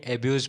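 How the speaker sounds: एब्यूज said "e-byooz", final e silent; correct pronunciation. Shown as a demonstration. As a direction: say एब्यूज in two syllables, with a "byoo" glide. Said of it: "पढ़ेंगे". (सही उच्चारण: एब्यूज, आख़िर का ई मौन)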